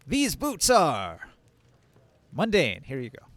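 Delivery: slow, solemn temple priestess; a lightly voiced stern priestess. Priestess voiced by game master.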